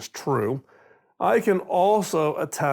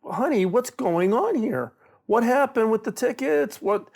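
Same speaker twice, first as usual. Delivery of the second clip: higher, pressed asking what happened; baffled husband demanding answers, pitched up; exasperated question; 7 semitones higher than usual. Retold or imitated. Husband on the phone asking his wife about tickets, exasperated and higher.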